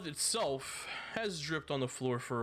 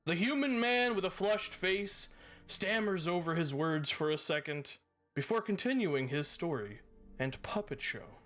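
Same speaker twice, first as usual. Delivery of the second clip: measured storyteller scene setting; game master narration; 8 semitones higher, 3 dB quieter than usual. A narrator's reading. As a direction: paint the scene for the players.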